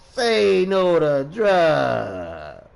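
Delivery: loud slogan with very long stretched words; sarcastic. Pretend. Drug user preaching against drugs on stream, sarcastic. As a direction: mockingly draw out every word, loud. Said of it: "I'm being sarcastic for real".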